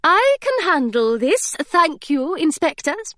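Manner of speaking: high, firm dismissive governess; with authority. Acted. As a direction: send the inspectors off with command. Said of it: "with such authority".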